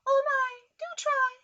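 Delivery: a tiny fly pleading in a thin high voice. Acted.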